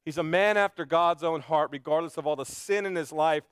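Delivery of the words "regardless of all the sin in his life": ordinary talk, nothing marked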